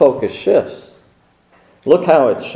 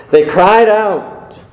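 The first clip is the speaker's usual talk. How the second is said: cried drawn out slow and higher; stress on cried; 6 semitones higher, 3 dB louder, much slower than usual. Shown as a demonstration. Preacher stressing the Israelites' cry to listeners, slow and lifted. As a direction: hold and lift the word cried. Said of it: "The emphasis is on the".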